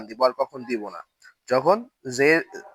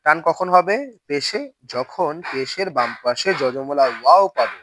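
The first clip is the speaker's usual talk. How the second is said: normal talking voice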